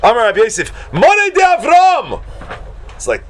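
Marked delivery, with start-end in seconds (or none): 0.9-2.2 high, drawn-out cry of astonishment; stretched, high-pitched appeal to heaven; shocked exclamation. Acted. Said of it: "he's, like, shocked".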